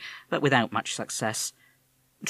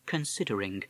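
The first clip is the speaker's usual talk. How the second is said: plain reading voice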